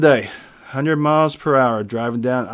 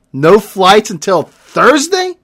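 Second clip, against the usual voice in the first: outraged; 6 semitones higher, 9 dB louder, slower than usual. Acted. Outrage at cancelled flight.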